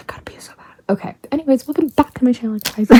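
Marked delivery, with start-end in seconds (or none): 0.1-0.7 barely audible whisper, breath only; faint whisper. Real, not acted. Vlogger alone in her room, faint desperate whisper. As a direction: hush it so nobody in the house hears. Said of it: "I'm gonna whisper".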